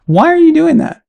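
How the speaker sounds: high incredulous cry; exasperated high-pitched why. Real, not acted. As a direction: throw up your hands in disbelief. Exasperated rant at commentator.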